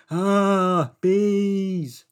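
loud, high mock scream of panic; hammed-up cry of alarm, stretched out.

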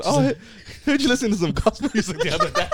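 high, sweet puppy voice. Acted. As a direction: coo it like greeting a puppy. Continues the puppy voice.

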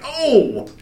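high, drawn-out scandalized groan; mock shock. Listener retelling the reaction to a comedian's joke, mock shock. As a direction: exaggerate being appalled, stretching it slowly.

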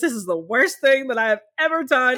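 exasperated groan. Retold or imitated. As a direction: act out being fed up at three A M. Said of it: "And I was like".

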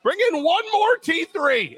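high, slow squeaky mock voice; imitating Peter Dinklage in Elf. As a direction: ham up the tiny angry elf, slow and high. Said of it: "Peter Dinklage just runs around".

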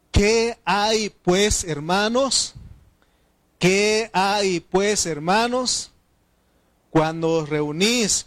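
slow, loud scripture proclamation; preach. Real, not acted. Preacher reading Corinthians to the congregation, loud, slow and emphatic. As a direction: declaim each phrase slowly at full force.

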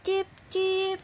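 high chirping bird voice; canary chirp. A narrator's reading. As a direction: squeak out a warning chirp. Canary warning repeated.

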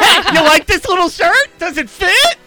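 high loud clown cheer; excited clown voice. Pretend. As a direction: play a goofy clown showing off. Clown pantomime continues.

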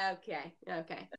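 retold soft, subdued acknowledgement; quiet acceptance. Traveler answering the man, retold quiet acceptance. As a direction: say it small and soft, giving in. Retells her reaction to the man.

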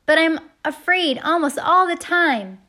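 high, loud shout over the wind; big strained holler; yelled confession. A narrator's reading. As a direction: yell a secret out into the night. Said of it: "he yelled".

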